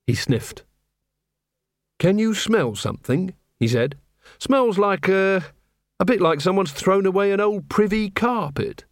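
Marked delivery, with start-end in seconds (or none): 2.0-8.8 put-on higher, puzzled young man; lightly voiced guileless copper; Carrot. A narrator's reading. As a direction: wrinkle the nose, innocently. Carrot sniffs.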